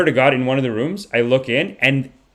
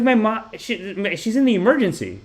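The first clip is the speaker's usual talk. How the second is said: high, slowed-down protest; acting out an indignant plea; imitated pleading; 8 semitones higher than usual. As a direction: plead it in a raised pitch. Retold pleading with cafe worker.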